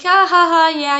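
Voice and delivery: high, loud dismissive laugh. Retold or imitated. Principal laughing off the mom's complaint, fake chuckle. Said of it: "laughing it off".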